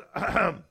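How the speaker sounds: interrupting "ahem"; pointed throat clearing. Acted. Holy Spirit stepping in to interrupt, pointed throat clear. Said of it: "steps in the middle and goes".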